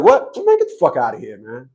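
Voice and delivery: sharp "What?" then a dropped brush-off; retold tough dismissal; dismissive brush-off. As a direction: snap at him, then dismiss him. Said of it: "But the way I said".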